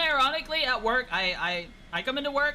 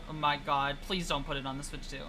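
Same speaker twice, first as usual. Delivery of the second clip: ordinary talk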